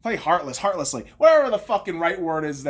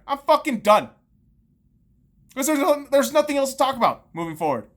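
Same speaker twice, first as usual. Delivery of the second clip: ordinary talk, nothing marked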